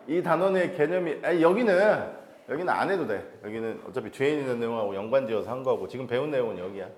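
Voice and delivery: normal talking voice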